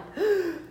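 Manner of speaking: high panicked gasp; a sudden high gasp of shock; gasping at bad news; sharp gasp. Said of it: "instant panic attack".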